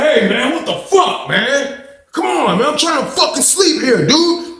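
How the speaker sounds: high whiny voice with a laugh; whiny complaint. Retold or imitated. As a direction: complain about being woken. Cellmate whining, woken.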